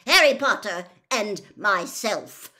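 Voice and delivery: loud, emphatic, indignant; barked. A narrator's reading. Senior official names himself and the boy, barking. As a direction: punch out each name. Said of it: "barked Mister Crouch".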